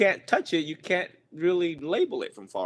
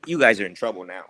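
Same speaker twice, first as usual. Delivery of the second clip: louder, lower mock warning; mock threat; 4 semitones lower, 7 dB louder, faster than usual. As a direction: pretend to threaten the class. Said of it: "let me whip out the big one".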